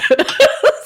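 bright rising giggle; short high laugh. Real, not acted. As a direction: let a real laugh bubble up. Laughing at own story.

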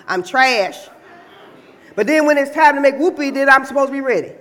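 loud, high opening challenge easing into a heated list; indignant woman laying down the law; fed-up woman. Acted. Woman refusing to let a man move in, indignant.

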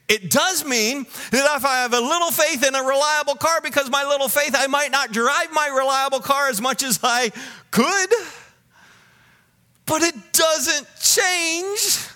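high, loud pulpit insistence; sustained high, emphatic delivery; forceful preaching. Real, not acted. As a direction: press the point at full preaching pitch.

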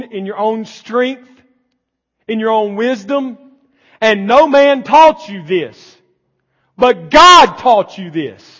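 fiery preaching. Acted. Pastor driving home grace to the congregation, fiery. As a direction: preach it to the back row.

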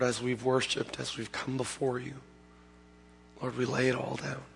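soft, hushed pastoral prayer; quiet prayer. Real, not acted. Pastor leading the church in quiet closing prayer. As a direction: pray gently over the congregation.

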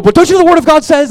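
high, shouted, preachy rebuke; loud, indignant acted outburst; mock scolding. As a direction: shout it like a Bible-thumper telling someone off. Acting out scolding someone with Bible.

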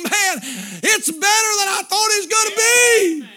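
high, loud cry of amazement; overwhelmed joy at full preaching pitch; overjoyed groom. Acted. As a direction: shout it out as an awestruck bridegroom, stretching the end. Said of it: "He's crying because he's saying".

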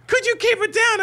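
complaining lady. Retold or imitated. Neighbour lady complaining about noise, high mock voice. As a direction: play the annoyed neighbour next door.

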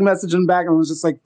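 ordinary talk, nothing marked

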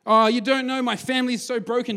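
high, loud mock whine; whiny complaint. Preacher mocking an excuse-making churchgoer, high whiny mock complaint. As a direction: moan it like a self-pitying churchgoer. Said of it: "you go".

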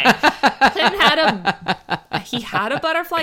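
laughing through the words; giggling while saying it; nervous laugh. Real, not acted. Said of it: "let's laugh now because it's gonna get so bad".

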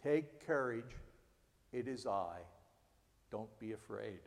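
gently calm a frightened friend; soft reassurance. Acted. Pastor repeating Jesus' words softly to comfort. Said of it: "reminder to provide comfort".

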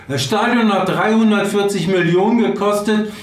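nachgespielte Wutrede, deutlich höher und energisch; empört auf die eigenen Leute losschimpfen; Uli Hoeneß. Retold or imitated. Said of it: "legendär gewordenen Wutrede". (zornige, aufgebrachte Standpauke mit hoher Stimme)

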